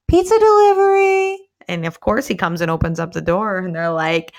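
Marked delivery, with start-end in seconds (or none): 0.1-1.4 high, loud sing-song delivery voice; sweet delivery girl. Retold or imitated. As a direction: chirp it like a cheerful pizza girl. Female officer poses as delivery.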